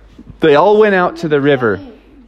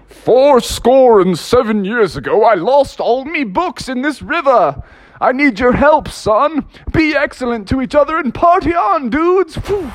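Honest Abe; 9 semitones higher than usual. Retold or imitated. Honest Abe asks the kids for help, hammed-up grand voice. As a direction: boom it like a hammed-up Lincoln speech. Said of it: "Honest Abe popped up and said".

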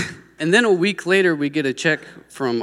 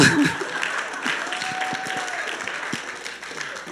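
a burst of high, breathy laughter; laughing at her own story, high and giddy; 6 dB quieter than usual. Real, not acted.

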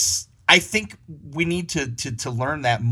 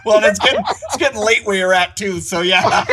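laughing; 6 semitones higher, 7 dB louder than usual. Real, not acted. Teasing host about the late hour.

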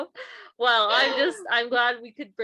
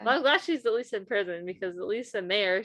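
normal talking voice

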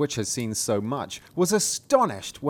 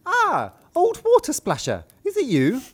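put-on much higher, louder girlish princess; 8 dB louder than usual. Princess answering the frog, high girlish squeal.

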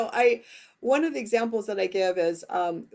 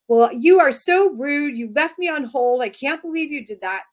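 accusing tone; 6 semitones higher, 4 dB louder than usual. Retold or imitated. Wife showing the wrong way to confront David, accusing. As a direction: snap at him as if he were rude. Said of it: "if I had said".

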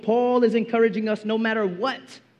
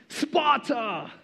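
put-on Spartan movie accent; 4 dB quieter than usual. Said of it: "I love their accents".

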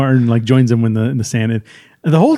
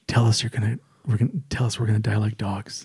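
low-key aside; 5 semitones lower, 11 dB quieter than usual. Acted. Acting out Lucky Day's request.

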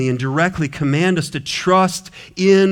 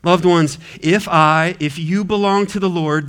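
usual speaking voice

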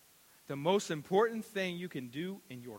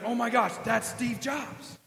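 higher, louder, rising excitement; starstruck fan; 7 semitones higher, 10 dB louder, slower than usual. Retold or imitated. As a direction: gush like a starstruck nerd. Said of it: "But he's like".

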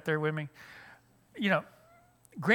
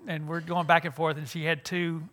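ordinary talk, nothing marked

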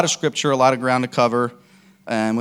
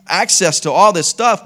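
preaching loud; 8 semitones higher, 9 dB louder than usual. Real, not acted. Preaching at full force.

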